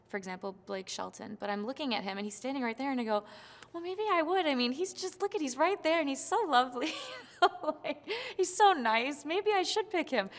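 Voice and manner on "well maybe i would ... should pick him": much higher, giggly, starry-eyed; smitten gushing. Retold or imitated. Contestant retelling being charmed by the coach, smitten and giggling. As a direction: gush like a fan charmed by him. Swooning over coach standing there.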